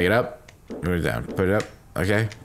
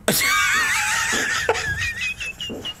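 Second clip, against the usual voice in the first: very high, wild cackling laugh; high hysterical shriek of laughter. Real, not acted. Shrill laugh after peeing self.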